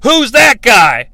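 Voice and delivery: loud, hammed-up panic at a monster; mock shouted surprise, slowing at the end; mock alarm. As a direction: gasp it out like a startled squad member. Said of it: "is like".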